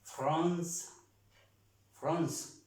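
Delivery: "France" in French, stopping on the S sound; "France" said for learners, final E silent. Shown as a demonstration. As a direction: repeat "France" as a model, no vowel after the C. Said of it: "no estamos pronunciando la E".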